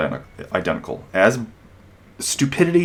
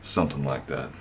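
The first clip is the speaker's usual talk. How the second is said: low, cool, understated cop mumble; badass cop; 7 semitones lower, 8 dB quieter than usual. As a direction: shrug it off like a tough cop. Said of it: "the badass cop goes".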